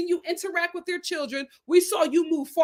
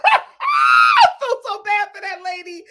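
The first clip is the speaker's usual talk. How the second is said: anguished cry; 7 semitones higher, 8 dB louder than usual. Real, not acted. Pitying mother on reality show.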